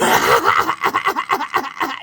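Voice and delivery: breathy high cackle; loud scornful laugh; loud mocking laugh. Real, not acted. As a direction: laugh in his face. Mocking Orlando Bloom.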